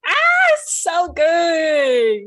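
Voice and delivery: a very high, loud cry of joy; host moved by the guest's story, crying out; excited cry. Real, not acted. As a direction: shout your delight, almost tearful. Host reacts to moving story.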